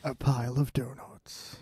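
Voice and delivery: low growling trailer voice, soft; lurid grindhouse trailer growl. Acted. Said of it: "a trailer".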